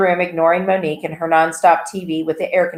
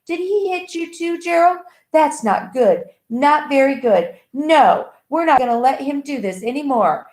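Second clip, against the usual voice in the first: dazed, not quite normal; 8 semitones higher than usual. A narrator's reading. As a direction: sound half awake from a nightmare. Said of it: "didn't sound quite normal".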